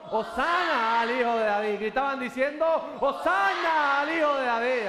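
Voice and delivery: high, full-voiced shout of acclamation; loud shouted praise, stretched out at the top; crowd shouting. Shown as a demonstration. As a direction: cry Hosana out like a roaring multitude. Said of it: "así estaban gritando".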